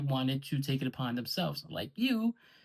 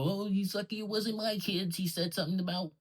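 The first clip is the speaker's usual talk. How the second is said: higher, mocking tough-guy quote; mocking impression; 5 semitones higher than usual. Mocking rivals' empty threats, a lightly voiced sneer. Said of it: "you guys who go".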